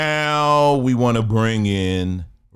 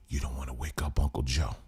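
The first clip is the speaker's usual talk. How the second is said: lower, much softer warning; low hushed caution; mock hushed warning; 8 semitones lower, 10 dB quieter, faster than usual. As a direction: hush them so the old man sleeps. Hushing voice continues.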